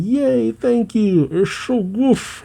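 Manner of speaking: high, drawn-out mock cheer of an excited kid; slow, high-pitched yay, lightly hammed up; gleeful child stuffing food. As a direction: voice a happy little girl diving into food. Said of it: "as she stuffs it down".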